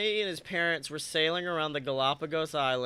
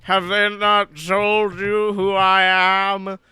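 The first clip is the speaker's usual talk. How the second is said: indignant sea god; 3 semitones higher, 10 dB louder than usual. Retold or imitated. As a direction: thunder it as an offended god. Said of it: "And he's just like".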